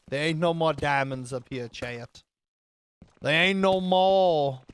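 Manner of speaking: drawn-out hammed-up American Southern drawl; exaggerated American accent. Streamer reporting to chat what the sniffer says, exaggerated American Southern accent. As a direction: stretch it out in a mock Southern accent. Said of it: "this is the most offensive accent I have ever done".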